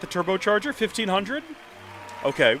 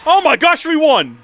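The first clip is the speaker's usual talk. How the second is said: high, loud burst of joy; excited shout; 11 semitones higher, 10 dB louder than usual. Real, not acted. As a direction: yell it like you just won. Unexpected win in the match.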